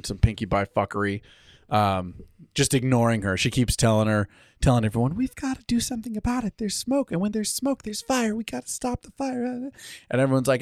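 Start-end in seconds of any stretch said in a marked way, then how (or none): 5.1-9.4 high soft pleading voice; anxious Fluttershy. Retold or imitated. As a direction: urge them timidly.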